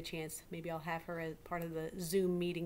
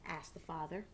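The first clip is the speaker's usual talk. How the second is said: put-on lively read-aloud to children; story narration. A narrator's reading. Teacher reading a picture book to her class, lively.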